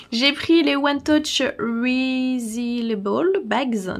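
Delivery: slow, self-conscious English accent on the product name; clumsy English accent. Shown as a demonstration. Collector names the bags she uses, in a clumsy English accent. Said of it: "Désolée pour mon accent, euh, anglais, euh, tout pourrave".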